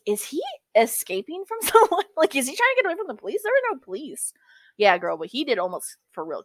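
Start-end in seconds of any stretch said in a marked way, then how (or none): 0.0-4.3 high, fast disbelieving rush; incredulous. Retold or imitated. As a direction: pitch it up in disbelief. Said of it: "I was like".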